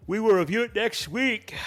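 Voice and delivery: talking through a yawn. Real, not acted. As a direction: yawn the words out, tired. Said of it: "that's me yawning 'cause I'm tired".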